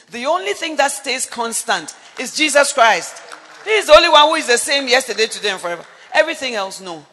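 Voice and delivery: loud, fast, ringing proclamation; preaching at full force. Acted. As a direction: preach it out to the whole church. Preaching climax.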